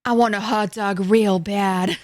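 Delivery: loud, slow, drawn-out celebrity impression; Jennifer Coolidge. Streamer quoting a hot dog line to chat, Jennifer Coolidge impression. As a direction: stretch every word out heavily, loudly. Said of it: "Jennifer Coolidge is in the chat".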